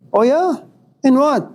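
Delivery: high, curious surprise; surprised interest. Retold or imitated. Professor hearing his student got into Yale, surprised. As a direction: raise your eyebrows in the voice. Teacher hears of Yale acceptance.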